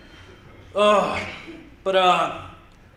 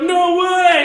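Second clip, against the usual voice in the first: high, loud "No way!"; mock excited kid; 9 semitones higher, 7 dB louder than usual. Kids joking about whey, yelling a high mock "No way!". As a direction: blurt it up high like a nineties kid. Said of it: "They'd be like".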